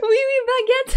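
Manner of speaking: teasing mimicry of a cliché French line; mock French cliché. French streamer mimics what new viewers always say, in a mock French cliché. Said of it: "That's exactly what the, all the new viewers said".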